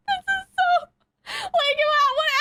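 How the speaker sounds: high-pitched, breaking into laughter; real laughing disbelief. Real, not acted. Viewer lost for words at an uncanny show, laughing in disbelief. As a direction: laugh helplessly through the words.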